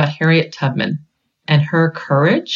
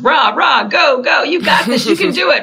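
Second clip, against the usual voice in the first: cheerleader; 7 semitones higher than usual. Retold or imitated. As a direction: cheer them on like a pep squad. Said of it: "I mean real cheerleaders".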